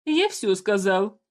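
slow cold final verdict; dark lord. A narrator's reading. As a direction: end it slowly, no argument. Ruler ends discussion.

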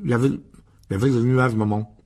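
loud, garbled protest through food; muffled words round a big bite; mouth full. A narrator's reading. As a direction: talk with your mouth stuffed. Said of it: "tu ne parles pas la bouche pleine".

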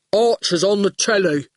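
dull oaf announcing; goon voice. A narrator's reading. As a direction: say it like a simpleton. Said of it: "said the goon".

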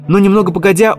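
ordinary delivery

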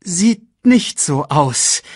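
high, slow, loud hyena; Shenzi. A narrator's reading. Hyena remarking on the grinning villain, high and slow. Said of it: "sagte Shenzi".

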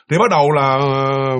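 usual speaking voice

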